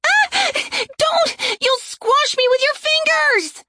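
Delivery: high, shrieking panic; terrified shriek. Acted. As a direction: recoil in terror and shriek. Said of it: "Aaron screamed and flinched away".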